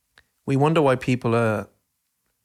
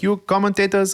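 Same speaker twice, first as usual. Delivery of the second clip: playful mock Dutch accent, a bit higher; 8 semitones higher than usual. Football manager teasing commentators, mock Dutch accent. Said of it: "that's my, um, Dutch accent".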